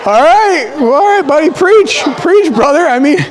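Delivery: high, loud hammed-up preaching; playful preacher praise. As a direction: cheer him on like an amen corner, half laughing. Mock preacher praise for his son.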